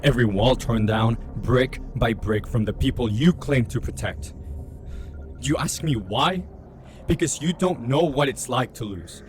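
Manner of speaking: usual speaking voice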